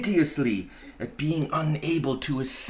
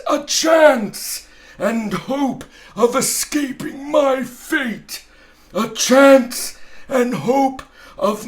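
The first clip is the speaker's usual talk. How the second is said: booming ghost; 10 semitones higher, 5 dB louder than usual. Acted. Marley's ghost warning Scrooge, loud and wailing. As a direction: proclaim it like a doomed ghost.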